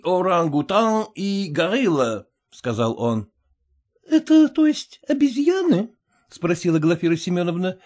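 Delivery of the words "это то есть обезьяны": lightly raised woman's voice; a touch higher, a wife asking; Glafira's voice. A narrator's reading. Glafira voiced, high pitched.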